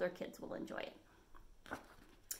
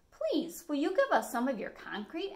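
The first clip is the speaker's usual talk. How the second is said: higher, polite little wolf cubs; little wolves; 6 semitones higher than usual. Acted. Wolf cubs ask the beaver for concrete, sweetly. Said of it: "asked the three little wolves".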